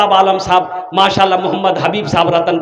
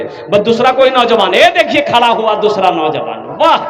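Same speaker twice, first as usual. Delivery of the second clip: ordinary talk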